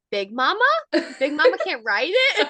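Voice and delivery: imitating a kid's puzzled question; incredulous toddler. Toddler questions mom about the tractor, lightly incredulous toddler. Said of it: "he looks at me and he goes".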